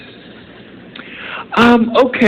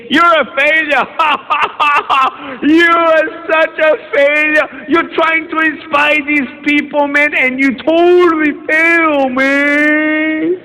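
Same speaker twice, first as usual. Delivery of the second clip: a higher, mocking inner voice; inner critic voice; 7 semitones higher than usual. His inner voice taunting him after the speech, mocking and higher. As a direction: sneer at yourself in a taunting voice. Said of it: "my voice in my head saying".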